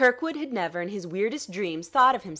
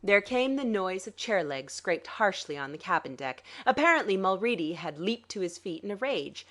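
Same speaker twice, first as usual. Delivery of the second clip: ordinary delivery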